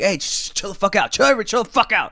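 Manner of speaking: high, strained hushing; urgent shushing. Retold or imitated. Narrator shushing freaking-out bandmates in customs, urgent. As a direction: act out frantically calming the group down.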